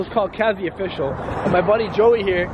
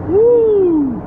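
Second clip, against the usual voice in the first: high, loud whoop; excited woo; 6 dB louder than usual. Real, not acted. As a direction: whoop at the height. Looking down the big drop.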